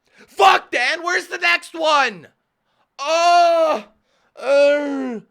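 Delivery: very loud, high, drawn-out yelling; mock enraged shout. Viewer demanding the next video from the YouTuber, mock rage. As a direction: bellow like an insatiable fan, hammed up.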